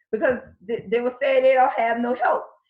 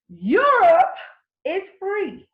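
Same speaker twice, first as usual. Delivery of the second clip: excited reveal; 7 semitones higher, 5 dB louder, much slower than usual. Real, not acted. Big reveal to viewers.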